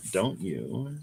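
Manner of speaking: ordinary talk, nothing marked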